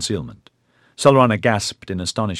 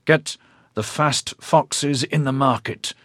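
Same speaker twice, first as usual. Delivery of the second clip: ordering; 6 semitones higher, slower than usual. A narrator's reading. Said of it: "the bandit ordered".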